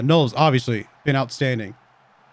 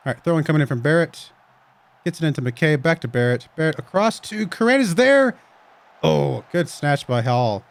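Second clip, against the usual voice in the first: lively match commentary; play-by-play; 3 semitones higher, 4 dB louder than usual. Acted. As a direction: call the game as it happens.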